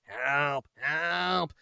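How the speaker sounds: soft, drawn-out plea; quiet put-on cry for help; mock feeble help call. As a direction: imitate a weak call from behind. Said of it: "I just hear from behind me".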